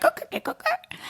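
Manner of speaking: breathy clicking through a laugh; voiceless bird clicks that go very high; alien crow clicks. Retold or imitated. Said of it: "like, alien".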